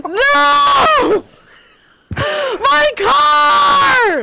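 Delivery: high desperate scream. Real, not acted. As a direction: scream over the wrecked car. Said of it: "the way you just screamed".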